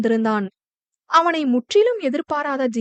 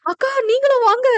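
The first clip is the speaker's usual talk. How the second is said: put-on very high, urgent boy's plea; alarmed, high-pitched cry; frightened calling; 10 semitones higher, 3 dB louder than usual. A narrator's reading. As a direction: call an elder sister out of danger. Boy calls sister from danger.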